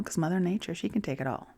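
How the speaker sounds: usual speaking voice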